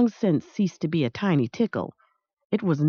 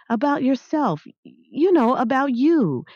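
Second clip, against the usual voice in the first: prodding; 8 semitones higher than usual. Acted. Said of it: "Maniac prodded".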